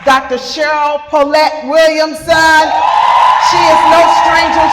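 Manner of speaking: loud, lifted, fast announcer call; loud hype intro for the second panelist; hype introduction. Real, not acted. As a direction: sell her to the crowd like a headliner.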